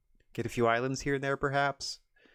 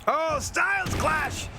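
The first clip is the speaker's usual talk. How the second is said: wrestling announcer screaming the win; excited commentary shout; 14 semitones higher, slower than usual. Acted. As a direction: call the finish at full hype. Play-by-play call of finish.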